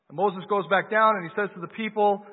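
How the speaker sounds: preaching at full force. Acted. Preacher telling the Exodus to the congregation, full-force preaching. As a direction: drive the story home to the congregation.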